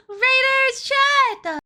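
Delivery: high, loud excited squeal; cute anime streamer. Real, not acted. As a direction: shout a squeaky welcome to the raiders. Greeting incoming raid.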